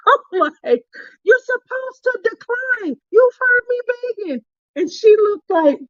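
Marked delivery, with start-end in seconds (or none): 1.2-4.4 high-pitched, indignant pleading; mock protest. Snack owner to friend who accepted the cowtail, mock protest.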